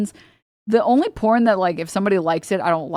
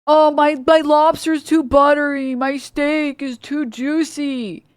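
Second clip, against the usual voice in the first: high, drawn-out whine; high mock whining, slowing at end; mock whiny complainer; 6 semitones higher, slower than usual. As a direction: whine like a spoiled diner.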